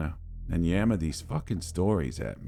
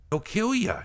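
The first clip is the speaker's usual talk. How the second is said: frightened warning. Retold or imitated. Sunburnt kid begging them.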